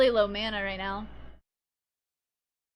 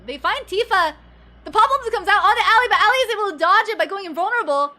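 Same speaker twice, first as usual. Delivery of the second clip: fast, high excited play call; 8 semitones higher, 9 dB louder, faster than usual. Real, not acted. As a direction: shout the play as it happens. Sudden play call while casting.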